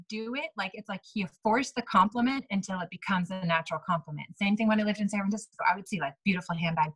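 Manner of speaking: ordinary delivery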